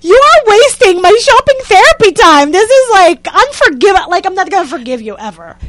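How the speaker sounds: high and loud, fuming at the professor; furious rant. Retold or imitated. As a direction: spit out the grievance, furious. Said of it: "smoke coming out of my ears".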